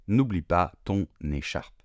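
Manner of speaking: ordinary talk, nothing marked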